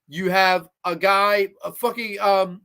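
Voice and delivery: usual speaking voice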